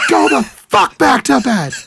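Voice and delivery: high mock yell. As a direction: bark it at someone who keeps getting up. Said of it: "I can hear Sophie yelling".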